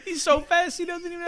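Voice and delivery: very high-pitched mock wiseguy heckle; New York wiseguy. Imitating connected friends teasing pantless Barney, high wiseguy voice. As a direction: crow it like a hammed-up mob buddy. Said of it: "a bunch of his old, like, New York, like, kinda connected friends".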